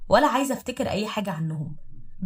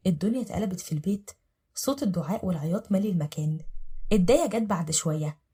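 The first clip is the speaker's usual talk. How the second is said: ordinary delivery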